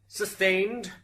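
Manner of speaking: loud, high pronouncement; firm ruling. Acted. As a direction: bang it down like a gavel. Judge rules on objection.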